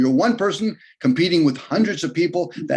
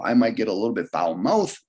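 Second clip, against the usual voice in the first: ordinary talk, nothing marked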